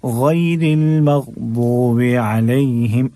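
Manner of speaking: very slow chanted verse; Quran recitation. Shown as a demonstration. Teacher recites the verse slowly as a model. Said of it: "गै पढ़ना है".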